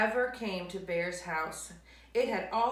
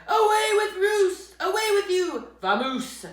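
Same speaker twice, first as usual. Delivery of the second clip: angry shouting; 12 semitones higher, 12 dB louder than usual. Acted. Bear chasing mouse off.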